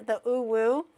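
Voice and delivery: usual speaking voice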